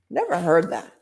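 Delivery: plain reading voice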